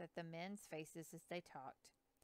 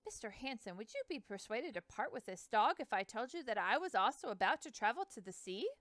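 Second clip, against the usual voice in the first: rushed; 4 semitones higher, 6 dB louder than usual. Acted. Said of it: "Lewis spoke in a rush of words".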